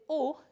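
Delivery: "o" loud and held as an accented ending; stressed O. Shown as a demonstration. Teacher showing the third-person past ending, stressed, held "o". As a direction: land firmly on a long "o". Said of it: "que lleva acento".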